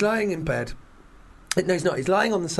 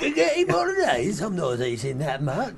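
Len Goodman. Acted. Judge refusing TV cook's foreign food, mock disgust. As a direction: squawk the dish name, then refuse it. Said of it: "has to make Len Goodman eat a spaghetti bolognese".

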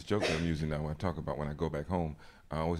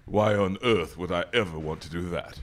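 put-on pompous British accent, louder; Peter Hitchens; 5 dB louder than usual. Brother refusing his sister's parsley tea, in Hitchens-style British accent. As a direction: answer in a mock-British pundit's accent. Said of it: "we call it my Negro Hitchens".

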